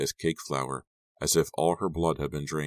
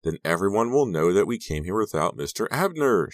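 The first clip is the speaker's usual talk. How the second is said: raised, louder, panicky outburst; distressed cry; 5 semitones higher, 6 dB louder than usual. A narrator's reading. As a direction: cry out in dismay. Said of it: "she cried".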